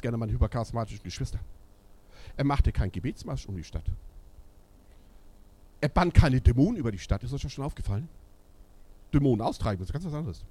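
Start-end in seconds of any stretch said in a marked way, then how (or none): none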